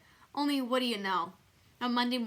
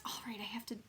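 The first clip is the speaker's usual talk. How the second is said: whisper; 10 dB quieter, faster than usual. A narrator's reading. Unvoiced whispered line.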